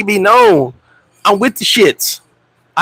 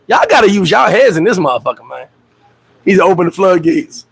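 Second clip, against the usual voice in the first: ordinary talk, nothing marked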